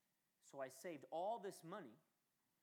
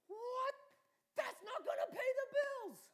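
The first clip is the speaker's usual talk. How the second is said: high, loud, half-laughing protest; hammed-up cry of disbelief; mock exasperated wail; 13 dB louder, much slower than usual. Arguing back with God.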